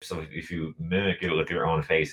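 usual speaking voice

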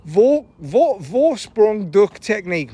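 slow, put-on German accent on the slogan; mock German accent. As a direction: say it like a German car ad, stretched out. Quoting German car slogan.

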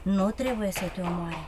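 usual speaking voice

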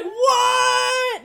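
high, loud mock shout of disbelief; shocked crowd cry. Whole theater reacting to the reveal, shocked. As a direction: cry out like a stunned audience.

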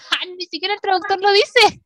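loud, high exclamation; surprised. Real, not acted. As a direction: burst out in disbelief. Translator fails to say word.

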